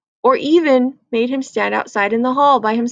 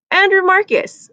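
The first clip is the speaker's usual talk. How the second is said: teacher calling; 8 semitones higher, 4 dB louder than usual. Acted. Teacher calls out student.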